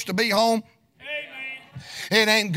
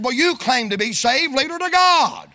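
retold unintended harshness; 3 semitones higher than usual. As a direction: challenge that jumps high on the ending. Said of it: "I didn't mean it to sound this way".

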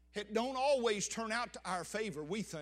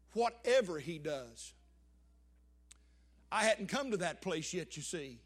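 usual speaking voice

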